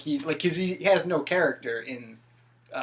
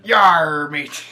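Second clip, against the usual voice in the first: pirate voice; 11 dB louder than usual. Retold or imitated. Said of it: "he talks like a pirate".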